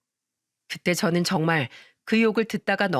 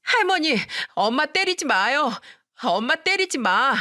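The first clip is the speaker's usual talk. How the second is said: put-on child's shout; 6 semitones higher than usual. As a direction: scream at grandma to stop, slowing at the end. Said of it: "시모한테 소리치더군요".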